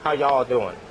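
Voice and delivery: put-on Southern US greeting accent. Reporter trying a greeting on someone, Southern accent. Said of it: "Oh, that's very southern".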